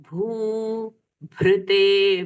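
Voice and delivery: very slow chant, भूभृते with भृ as भ्रू; bhru sound. Shown as a demonstration. Teacher showing the भृ sound, very slow chant. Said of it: "भृगु ऋषि वाला भ्रू".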